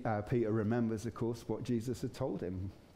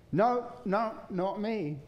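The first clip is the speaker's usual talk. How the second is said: pitched up and quick, anxious to be believed; Peter's denial; 7 semitones higher than usual. Retold or imitated. Peter denies again.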